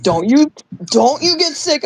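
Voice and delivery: mild mock yell; mock scolding yell. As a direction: imitate a friend sternly warning. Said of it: "she's yelling at me".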